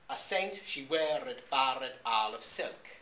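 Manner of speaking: slow, loud verse beat on "A saint she weared barred all of silk"; metrical reading. Shown as a demonstration. Lecturer reading Chaucer's line for its rhythm, slow metrical stress on "saint", "weared", "barred". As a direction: recite "A saint she weared" slowly, stressing every other syllable. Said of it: "in order to keep that rhythm in the line".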